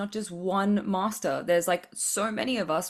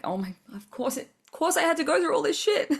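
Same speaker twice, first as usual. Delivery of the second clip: exasperated; 8 semitones higher than usual. Retold or imitated. Exasperated realization, building up.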